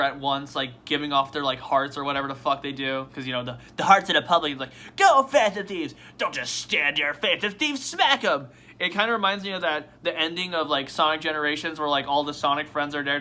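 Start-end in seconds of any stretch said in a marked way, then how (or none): 5.0-8.5 raised mock cheer, loud at the start; cheering crowd. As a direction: egg on your heroes like a crowd. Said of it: "they're, like, cheering".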